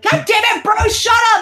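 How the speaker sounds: high, loud mock shout at a coworker; mock yell. Coworkers yelling at Bruce to shut up, mock shout.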